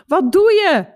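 high, loud cry of dismay; aghast shout. A narrator's reading. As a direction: shout it out, appalled. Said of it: "schreeuwde ik ontzet".

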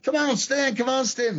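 high, whiny mock plea; Cornette pleading. Cornette begging Stan, mock high whine. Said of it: "and he's like".

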